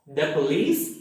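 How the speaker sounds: short thuh in "the police"; "the" ends in a short vowel, not ee; weak the before consonant. Shown as a demonstration. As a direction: say "the police" with a quick, unstressed "the". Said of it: "this word is pronounced".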